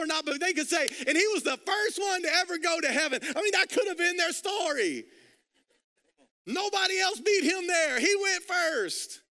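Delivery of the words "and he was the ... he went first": high, loud preaching climax; preaching at full force. Real, not acted. Preacher to congregation about the first in heaven, loud triumphant preaching. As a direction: lift the crowd with triumph.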